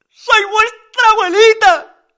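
very high, loud, slow falsetto; sing-song it up high and stretched; put-on granny. A narrator's reading. Susana revealing herself to the family, high granny falsetto.

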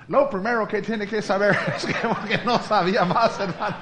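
loud, laughing through the words. Retold or imitated. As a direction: crack up mid-quote. Quote breaking into laughter. (riéndose a carcajadas)

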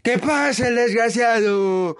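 high, slow, drawn-out mock host shout; slow high talk-show shout; catchphrase shout. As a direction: bellow it like a TV host calling the guest in. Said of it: "Laura como que hacía los programas muy interactivos y decía".

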